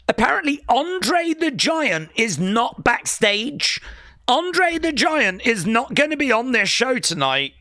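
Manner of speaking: hammed-up shock newsflash; mock breaking news. Streamer jokes about breaking backstage news, mock astonishment.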